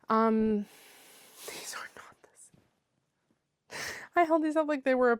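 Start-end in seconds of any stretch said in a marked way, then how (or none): 1.5-3.8 drawn-out voiceless whisper; slow whisper. Real, not acted. Noticing the wrong slippers, to herself, whispered. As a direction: whisper the mix-up, dismayed.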